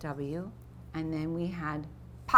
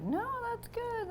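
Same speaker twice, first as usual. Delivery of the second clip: put-on kids interjecting; 7 dB quieter than usual. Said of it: "they're like".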